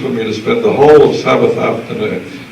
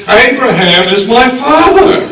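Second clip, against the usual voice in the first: proud declaration; 11 semitones higher, slower than usual. Retold or imitated.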